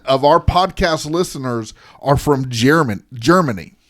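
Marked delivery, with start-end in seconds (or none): none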